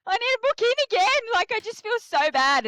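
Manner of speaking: high pleading whine; high distressed plea; mock desperate plea. Retold desperate self-quote.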